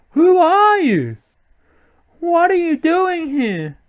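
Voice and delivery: high, slow put-on girl voice; confronting girl. Girl confronting strangers, high slow voice. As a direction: question them in a high girlish pitch.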